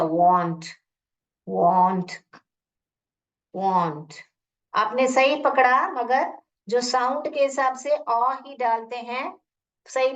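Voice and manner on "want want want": "want" said low with a clear a sound, wahnt. Shown as a demonstration. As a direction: stretch the vowel of "want". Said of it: "देखिए मैंने जो सोचा".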